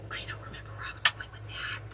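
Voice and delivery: whispered aside to self; whispering. Real, not acted. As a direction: hush it to yourself. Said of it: "whispered aside about forgetting".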